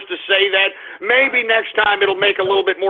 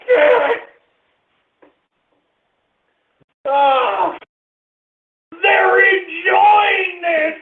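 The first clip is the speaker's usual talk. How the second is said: much higher, slow stretched cries; anguished ranting wail; 9 semitones higher, slower than usual. Real, not acted. Host wailing that Twitter enjoys his meltdown. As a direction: wail like the world is against you.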